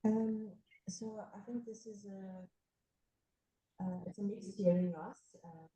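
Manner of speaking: far from the mic, muffled; speaking away from the microphone, muffled. Real, not acted. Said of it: "You guys are kind of away from the mic".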